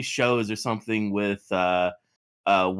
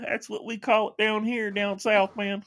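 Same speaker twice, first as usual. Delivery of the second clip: high drawling southern guy; southern guy impression; 11 semitones higher than usual. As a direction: play a laid-back southern gamer, pitched up. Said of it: "That imitation's too good".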